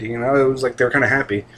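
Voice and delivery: normal talking voice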